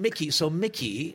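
ordinary delivery

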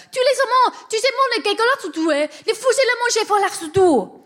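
high, loud mock French gibberish; fake French. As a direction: shout made-up French with gusto. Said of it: "which is fake French".

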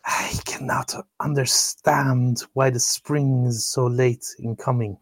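whispered, slow, the wrong character voice. Shown as a demonstration. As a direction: breathe it out in a whisper. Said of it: "Oh, wrong voice".

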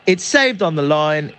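normal talking voice

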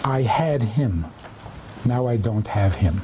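understated grief, pitched low; grieving. Retold or imitated. Grieving widow's answer, retold.

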